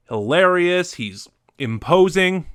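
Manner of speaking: ordinary talk, nothing marked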